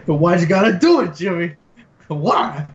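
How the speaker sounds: loud, high hammed-up anguished plea; overacted death scene. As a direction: wail it like a melodramatic dying friend. Riffing a movie death scene.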